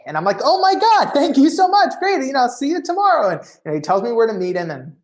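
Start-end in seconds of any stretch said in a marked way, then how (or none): 0.4-3.3 much higher, louder gushing thanks; thrilled fan thanking Larry King; overjoyed. Retold or imitated. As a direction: burst with giddy gratitude.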